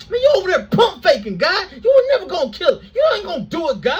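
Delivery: high, fast, whiny rant near laughing; exasperated crash out. Acted. Jonah ranting at God that he knew he'd show mercy, high and fast. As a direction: vent at God like a fed-up friend. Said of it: "Jonah starts having a whole long crash out".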